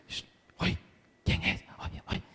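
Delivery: hushed, breathy little whisper; frightened whisper. Acted. Little goats whispering to each other about the knock.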